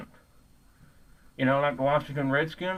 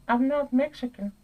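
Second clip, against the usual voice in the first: much higher put-on voice; mocking imitation; 13 semitones higher than usual. Retelling the accused man's denial, in high mocking imitation. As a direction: mimic the other man in a high mocking voice. Mocking quote of the other man.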